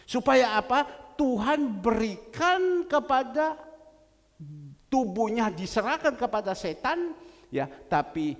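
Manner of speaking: loud, driving preacher delivery, rising to the peak; full-force preaching. Acted. Pastor preaching loudly to his congregation about sin. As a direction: hammer the point home from the pulpit.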